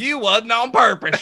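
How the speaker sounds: hammed-up country accent, stretched out with laughter. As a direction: drawl it out in a playful country accent. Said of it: "did a country accent".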